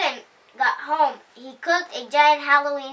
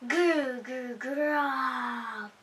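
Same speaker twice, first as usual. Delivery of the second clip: growling noise; 6 dB quieter, much slower than usual. A narrator's reading.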